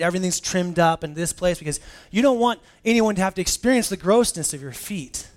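rising pulpit energy, fast; full-force preaching. Acted. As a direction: preach it hard to the room.

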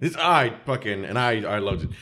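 ordinary talk

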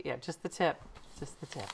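under breath. Real, not acted. Sneaking a cheeky aside to her cohost, hushed. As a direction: toss it off under your breath. Joking aside, dropped low.

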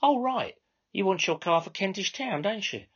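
high, brisk Cockney controller; high chatty controller. Acted. Controller to narrator, brisk and chatty. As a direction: size up the customer.

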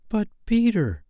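put-on high, lightly pleading girl voice; pleading Wendy. A narrator's reading.

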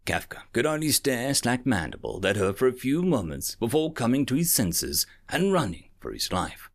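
ordinary delivery

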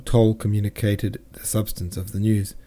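plain reading voice

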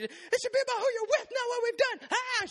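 high, loud, fast sobbing protest; mock bawling. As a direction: ham up a crying girlfriend. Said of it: "she's bawling, crying".

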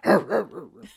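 loud barking "rah" growl; mock dog growl; growling grouch. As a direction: bark it like an angry dog. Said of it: "talked like that".

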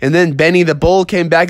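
ordinary delivery

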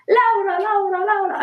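high, sing-song calling out; Italians calling a name. Retold or imitated. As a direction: call the name like an excited Italian crowd. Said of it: "my other favorite is".

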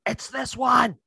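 high, strained pretend yelling; mock listener yell. Imagined listener yelling at the podcast, mock yell. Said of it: "somebody's just yelling at their".

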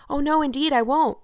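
put-on an eager child's quick promise; eagerly. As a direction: rush to promise, bubbling over. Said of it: "said Anne eagerly".

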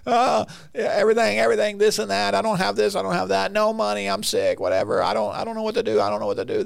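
high, whiny hammed-up panic; mock freaking out. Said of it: "they're freaking out".

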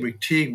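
"McTeague", rhyming with league; McTeague with long E. Shown as a demonstration. Host offering possible pronunciations of the guest's name, "McTeague" with long E. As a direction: say "McTeague" with the second syllable like "teeg". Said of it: "correct pronunciation of your name".

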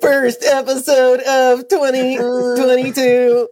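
high, slow laughing robot. Pretend. Mocking robot voice back.

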